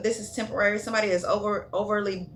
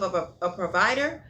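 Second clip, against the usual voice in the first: stumbling over the little word before "provider"; stuttering. Real, not acted. Tarot reader tripping over the provider line, stuttering. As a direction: get stuck repeating "a" before "provider". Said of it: "Why is I'm stuttering?".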